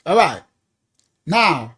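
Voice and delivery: ordinary talk, nothing marked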